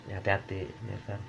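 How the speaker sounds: ordinary delivery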